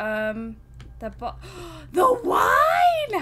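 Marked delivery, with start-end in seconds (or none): 1.9-3.2 very high, loud, drawn-out cry; horrified wail. Acted. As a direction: wail over a tragic loss. Wine bottle breaks.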